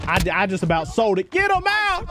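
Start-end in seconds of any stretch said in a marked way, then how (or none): 1.3-2.1 a quick, high, sharp shout; urgent yell. Real, not acted. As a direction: bark the order at your squad. Yelling at teammates mid-fight.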